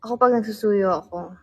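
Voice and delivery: ordinary delivery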